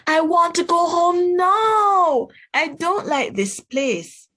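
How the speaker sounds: put-on high, loud childish whine; whiny upset child. Josh whining to Mom at the checkout, loud childish whine. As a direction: whine like a kid fed up in a queue.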